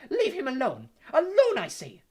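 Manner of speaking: commanding high, loud shout; Professor McGonagall. A narrator's reading. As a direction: shout an indignant order into the darkness. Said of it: "said Professor McGonagall's voice through the darkness".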